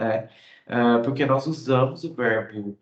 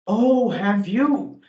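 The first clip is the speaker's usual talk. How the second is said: higher, slow "Oh, have you?"; surprised interest; 7 semitones higher, 5 dB louder, much slower than usual. Shown as a demonstration. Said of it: "expressando surpresa e interesse". (surpresa interessada, mais aguda e lenta)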